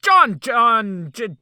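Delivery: calling out. Real, not acted. Calling out to Johnny.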